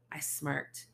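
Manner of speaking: plain reading voice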